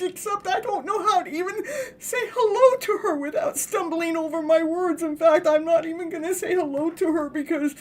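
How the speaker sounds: high, whiny and half-crying; lovesick nervous man. Retold or imitated. The divine masculine confessing his fear of her, high and mock-tearful. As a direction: fret like a tongue-tied guy in love. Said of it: "the divine masculine goes".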